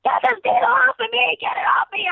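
high shrieking panic about a bug; retold panic, yelled high and loud at the costume ladies; panicked screaming. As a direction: shriek it like a bug is crawling on you. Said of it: "I'm screaming my head off".

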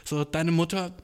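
ordinary delivery

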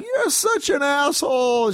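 high, slow imitated wail; retold weepy indignation; tearful outrage. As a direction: whine it through mock tears. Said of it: "so my sister starts crying".

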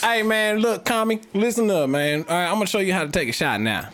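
put-on good-old-boy Southern accent calling for attention; Southern American accent. Player teaching friend shots, hammed-up Southern accent. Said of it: "Southern American right there".

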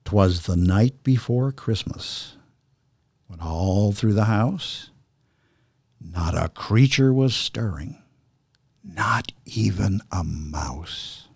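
slow, measured recital of a Christmas poem; unhurried storyteller recital; dramatic poem reading. Acted. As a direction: read it like a fireside poem. We are going to do a dramatic reading.

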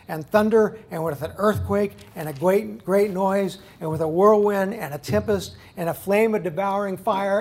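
loud, rising scripture read, each phrase hammered; fiery sermon reading; preaching at full force. Acted. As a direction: thunder the verses out to the congregation.

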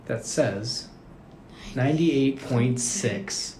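drawn-out, deliberate punchline delivery; slow reveal. Real, not acted. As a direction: spell out the reading slowly for effect.

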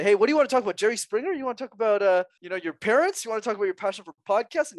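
high-pitched, keen mock offer; mock eager invitation. Pretending to let a child pick any topic, mock eager. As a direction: pitch up high, playfully offering choice after choice.

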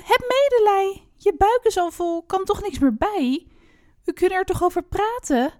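high-pitched pleading little pig; acted squeal. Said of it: "Het biggetje gilde". (gespeeld gillend, smekend biggetje)

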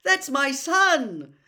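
high, loud, stretched-out yell. A narrator's reading. Father shielding his son from the wizards' spells, yelling. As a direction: yell in alarm to protect your child. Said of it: "yelled a voice he recognized".

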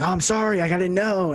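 earnest retold sorry; sincere apology. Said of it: "that's a little condescending attitude".

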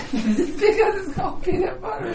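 giggling high-pitched protest; laughing squeal. Real, not acted. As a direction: squeal it out while cracking up. Laughing in argument.

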